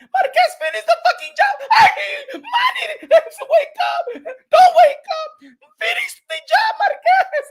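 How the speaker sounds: high, laughing, hammed-up wife voice yelling; mock Jinky. Wife mocking her husband's fight, in a high shrieking voice. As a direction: camp it up as the frantic wife cheering the opponent. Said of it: "she was like".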